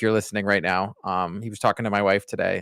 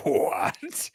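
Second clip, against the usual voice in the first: surprised; 6 semitones higher than usual. Retold or imitated. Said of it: "And she was like".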